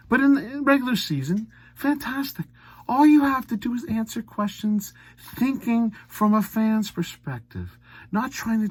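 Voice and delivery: sarcastic praise. Real, not acted. Sarcastic praise, then lecture.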